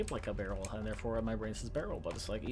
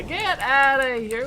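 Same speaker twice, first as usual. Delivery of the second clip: higher, loud, slow mock taunt; high drawn-out taunt; 13 semitones higher, 12 dB louder, slower than usual. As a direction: draw it out, taunting.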